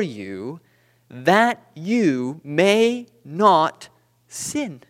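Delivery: slow, weighty scripture recitation; scripture reading. Acted. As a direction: read the verse out slowly, drawing out key words. Reads scripture aloud.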